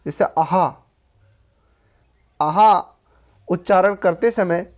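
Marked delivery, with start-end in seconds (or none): 0.2-2.7 visarga हा pushed from the throat; throaty हा in अहः. Shown as a demonstration. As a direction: sound the breathy ह ending of अहः. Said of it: "कंठ से होता है".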